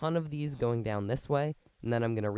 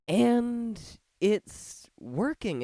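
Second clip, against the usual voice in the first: excited; 10 semitones higher, 4 dB louder, much slower than usual. Real, not acted. Machine runs after hours.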